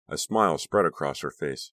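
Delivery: usual speaking voice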